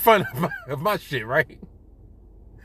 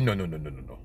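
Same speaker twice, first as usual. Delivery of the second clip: hushed refusal; 14 semitones lower, 10 dB quieter, faster than usual. Retold or imitated. Barber refusing, retold.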